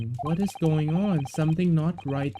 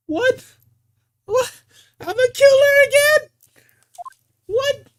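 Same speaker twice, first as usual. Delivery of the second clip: much higher, squeaky yelps of shock, fast; shocked disbelief; 8 dB louder than usual. Acted. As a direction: caught off guard and outraged. Defendant shocked at being accused.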